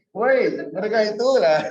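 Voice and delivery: loud call of Woi to wake listeners up; attention-calling shout. Shown as a demonstration. Teacher calling out Woi so students listen. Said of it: "Lagi-lagi ya".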